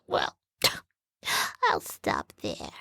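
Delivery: whispered, coy trailing off. Real, not acted. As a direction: drop to a sly whisper.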